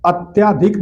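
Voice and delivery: ordinary delivery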